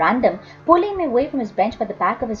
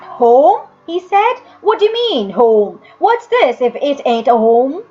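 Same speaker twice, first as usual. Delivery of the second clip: sneering workhouse man, loud; mocking old man; 2 semitones higher, 7 dB louder than usual. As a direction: scoff at the boy as a rough old man. Joseph mocks the boy harshly.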